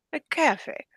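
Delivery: imitated Jordanian accent, high; strong Arabic accent. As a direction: insist on "cafe" for coffee in an Arabic accent. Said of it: "somebody with a strong accent".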